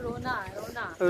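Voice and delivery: mock crying. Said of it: "रोना आ रहा है गाइस".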